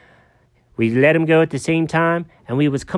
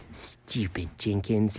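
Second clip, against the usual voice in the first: muttered self-scolding; 8 semitones lower, 13 dB quieter, slower than usual. Acted. Jenkins scolds himself.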